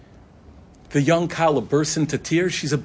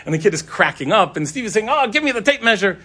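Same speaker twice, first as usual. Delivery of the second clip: lightly voiced jolly grown-up chasing a kid; higher, teasing call to a giggling child; playful teasing; 7 semitones higher, 3 dB louder than usual. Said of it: "jolly, sweet guy".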